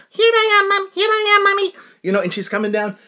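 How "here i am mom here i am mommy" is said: put-on very high, loud squeaky child voice; tiny toddler girl. Tiny daughter calling to her mommy in the store, squeaky toddler voice. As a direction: call out as a little toddler. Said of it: "looks like she's two and a half".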